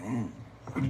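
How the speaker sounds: low, very soft mock grumpy hum; irritated grunt, imitated. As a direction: grunt a sullen reply under the breath. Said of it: "He was irritated".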